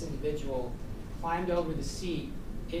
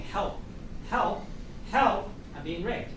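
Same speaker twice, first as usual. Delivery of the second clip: raised, somewhat louder help help; mock call for help; 5 semitones higher, 3 dB louder, slower than usual. Lawyer voicing an example cry for help to the witness, half-hearted. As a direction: act out a cry for help, measured and slow. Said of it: "calling out to people for help".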